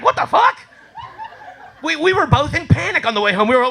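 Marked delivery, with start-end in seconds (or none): 0.0-0.6 shocked yell. Retold or imitated. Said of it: "I was like".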